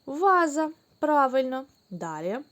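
ordinary delivery

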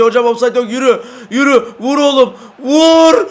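high, loud urgent calls to shoot; excited urging cry. Real, not acted. Gamer yelling at his striker to shoot. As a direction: egg the striker on. Cheering teammate toward goal.

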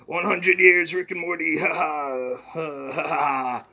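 lightly voiced boastful old scientist; Rick voice. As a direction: brag to Morty as a cocky grandpa. Rick figure again.

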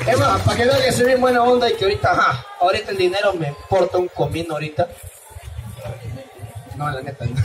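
ordinary delivery

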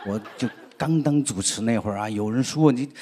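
ordinary delivery

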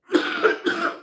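a sharp, dry cough that breaks off the speech; real coughing fit. Real, not acted. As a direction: cough once, hard, mid-rant. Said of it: "खोकल्याचा त्रास जरा आतीच होतोय आज".

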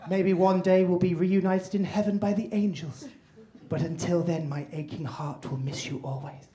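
hammed-up grieving mum, long pauses; eulogy voice. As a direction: say goodbye softly, dying away to a whisper. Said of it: "essentially just a fucking eulogy".

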